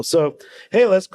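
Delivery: usual speaking voice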